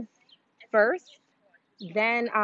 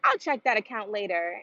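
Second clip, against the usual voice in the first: casual rich client; 3 semitones higher than usual. Retold or imitated. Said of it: "their response to it is like".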